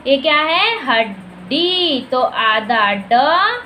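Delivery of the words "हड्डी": "हड्डी" split at the double ड, second syllable stretched; very slow "had-D" for spelling; sounding out. Shown as a demonstration. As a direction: slowly dictate "हड्डी" in two parts. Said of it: "तो हमें क्या करना पड़ेगा".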